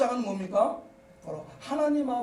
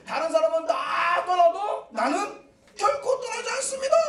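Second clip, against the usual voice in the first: Peter's vow cried out high and loud; confident vow; 5 semitones higher, 4 dB louder than usual. Acted. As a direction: swear it boldly as Peter. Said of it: "자신 있게 맹세합니다". (자신 있게 맹세하는 베드로)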